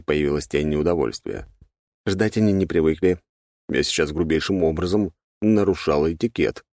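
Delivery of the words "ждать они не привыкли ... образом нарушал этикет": ordinary delivery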